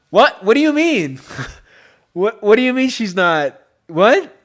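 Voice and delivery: high, loud comic disbelief; incredulous outcry. Real, not acted. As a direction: protest loudly, half laughing. Disbelief at actress married to man.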